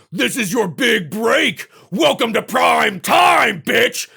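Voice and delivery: slow, loud villain sneer; hammy Freddy Krueger taunt; Freddy's taunting line. Retold or imitated. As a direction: gloat over the victim, slow and loud. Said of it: "when Freddy Krueger lifts her".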